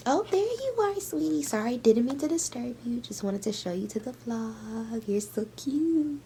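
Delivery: sweet high voice to her cat. Real, not acted. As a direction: coo at the cat.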